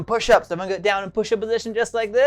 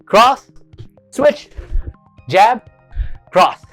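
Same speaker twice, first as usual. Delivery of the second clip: loud, higher calls; shouted drill commands; 5 semitones higher, 5 dB louder, much slower than usual. Shown as a demonstration. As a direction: bark out each strike. Calling out punch combo.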